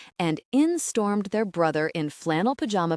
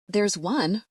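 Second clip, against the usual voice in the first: ordinary delivery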